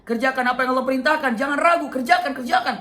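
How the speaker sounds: high, loud urging; urgent command. Acted. A voice urging Ibrahim to obey, high and loud. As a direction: urge him on, loud and high.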